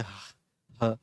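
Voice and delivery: "ह" as a light puff of air; airy, unforced h. Shown as a demonstration. Teacher showing the visarga ending, airy unforced h. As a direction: release "ह" like escaping air, without forcing it. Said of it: "जिस तरह से हवा की आवाज़ आती है".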